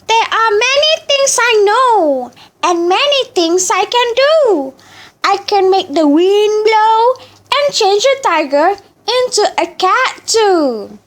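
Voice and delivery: put-on high, proud old chicken; wise chicken voice. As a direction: boast like a wise old bird.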